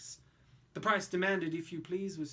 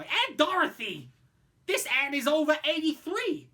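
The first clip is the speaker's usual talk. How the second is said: put-on loud boy; 8 semitones higher, 9 dB louder than usual. Roy presents aunt.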